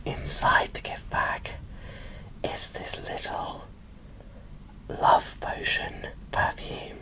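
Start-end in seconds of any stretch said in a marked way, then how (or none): none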